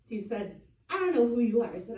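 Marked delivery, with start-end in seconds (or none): 0.9-1.7 loud, irritable brush-off; cranky. Retold or imitated. Gary's wife to a neighbor at the door, cranky and loud. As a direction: imitate a cranky woman, loudly.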